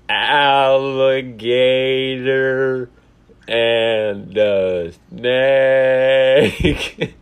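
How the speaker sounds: very slow, stretched, loud drawl; imitating a choppy call. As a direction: play the words like a glitching phone. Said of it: "All I've been hearing is you going".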